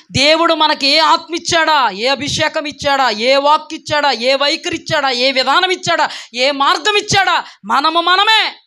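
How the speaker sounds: insistent, building pulpit cadence; preaching. Real, not acted. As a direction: hammer out each phrase in a rising rhythm.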